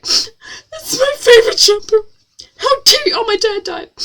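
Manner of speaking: very high, loud, hammed-up whine; mock a crybaby with a squeaky-high wail; mock whining. Mocking the heroine crying over jumper.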